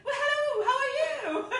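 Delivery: high, put-on social voice with a laugh; changed greeting voice. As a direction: gush a greeting in a high fake voice. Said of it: "she would always change her voice".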